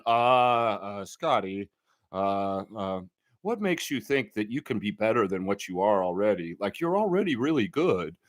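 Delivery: droopy Eeyore mumble; mocking the reporter. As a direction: drag it out like a gloomy reporter. Mimicking Grange's question.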